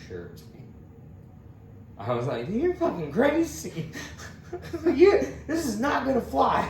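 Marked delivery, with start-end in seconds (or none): 2.5-6.7 high, loud angry retort through laughter; mad retort. Retold or imitated. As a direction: snap back furious but laughing. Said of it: "I was so mad".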